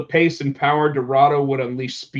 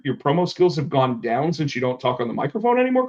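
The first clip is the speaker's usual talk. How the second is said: usual speaking voice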